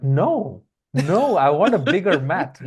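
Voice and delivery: put-on whiny kid insisting on a bigger mat; childish protest. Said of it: "I would be like".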